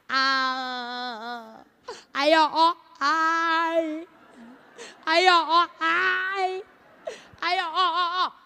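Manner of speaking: snivelling, wet sobbing; messy snotty wailing; snotty cry. Shown as a demonstration. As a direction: blubber with a runny nose. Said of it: "Agora choro meleca".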